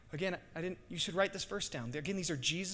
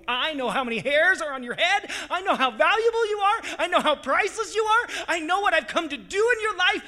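much higher, louder, rising fervour; impassioned Jesus; 13 semitones higher, 13 dB louder than usual. Acted. Jesus to the believer, loud impassioned promise. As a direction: pour it out with burning love. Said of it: "he's like".

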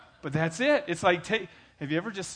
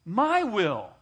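high loud demanding prayer; preacher mocking selfish prayers, high and loud; 10 semitones higher, 10 dB louder than usual. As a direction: mimic a pushy believer telling God. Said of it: "we're saying".

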